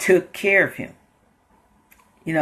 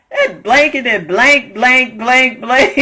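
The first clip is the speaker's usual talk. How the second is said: higher, louder fake curse words; mock cussing; 7 semitones higher, 5 dB louder, slower than usual. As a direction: spit out blanks in place of curses. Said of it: "he ain't gonna use the cuss words".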